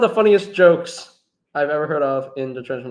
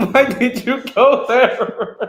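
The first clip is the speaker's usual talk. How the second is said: high squeaky laughter through words; laughing; 12 semitones higher, 7 dB louder, much slower than usual. Real, not acted. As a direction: laugh helplessly while asking. Friend laughing helplessly at joke.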